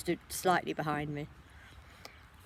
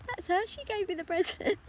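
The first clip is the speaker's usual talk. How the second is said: very high, imitated child's voice; mimicking a delighted little kid; excited little boy. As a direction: squeal it like a small child pointing someone out. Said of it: "Ben pointed at me and went".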